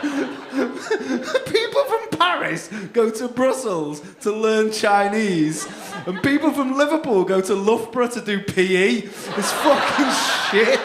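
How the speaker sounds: slow, high, loud rant broken by laughter; laughing rant. Real, not acted. Comic ranting about England to the French couple, laughing loudly. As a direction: rant while cracking up.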